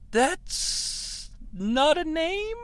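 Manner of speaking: "name" lifted high into a question; statement as a question. Retold or imitated. Alex perplexed by her answer, "name" rising questioningly. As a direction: softly stretch "That's", then swing "name" upward. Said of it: "With a question mark on the end".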